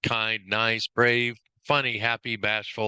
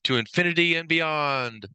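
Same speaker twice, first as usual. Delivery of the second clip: lightly acted space hero, stretching the last word; Buzz Lightyear; 4 semitones higher than usual. Trainer quoting a toy hero's catchphrase, lightly acted hero. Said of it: "The line I associate with him is".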